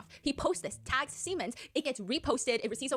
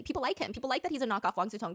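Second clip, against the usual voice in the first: ordinary delivery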